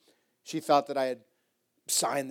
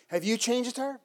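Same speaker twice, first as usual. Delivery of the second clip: mock disbelief; 9 semitones higher, 3 dB louder than usual. Mocking quiz of the boys.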